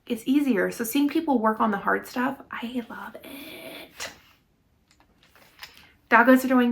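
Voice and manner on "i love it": soft unvoiced whisper. Real, not acted. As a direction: breathe it in a whisper. Quiet aside about loving effort.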